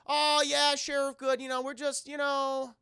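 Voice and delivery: high, loud innocent act; mock nervous Josh. Josh answering the sheriff, nervously high. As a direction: play it cool, badly. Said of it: "He's like".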